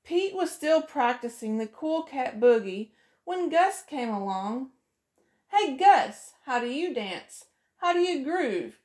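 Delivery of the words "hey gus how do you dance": ordinary delivery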